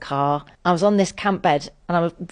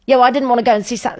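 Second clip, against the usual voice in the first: fast, much higher and louder sulky comeback; petulant snap at the friend, rushed and high; childish retort; 8 semitones higher, 7 dB louder, much faster than usual. Retold or imitated. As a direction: blurt it out like a stung kid. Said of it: "something really childish".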